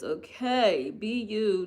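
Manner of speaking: ordinary talk, nothing marked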